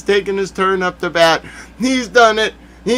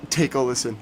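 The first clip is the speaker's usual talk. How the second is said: ordinary delivery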